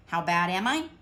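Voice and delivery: ordinary delivery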